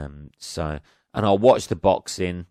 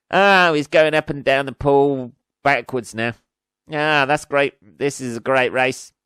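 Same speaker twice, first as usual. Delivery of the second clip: mock commentary; 5 semitones higher, 6 dB louder than usual. Mocking bored swimming commentary.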